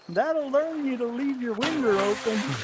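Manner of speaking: high, loud Southern Alabama holler; Southern Alabama accent. Retold or imitated. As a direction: mimic her thick Southern Alabama twang, loud and high. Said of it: "that is so Southern Alabama".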